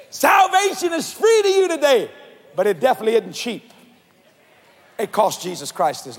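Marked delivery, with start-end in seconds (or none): 0.1-2.1 preaching shout. Real, not acted. Preacher proclaiming to congregation.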